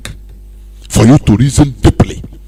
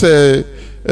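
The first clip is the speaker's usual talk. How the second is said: usual speaking voice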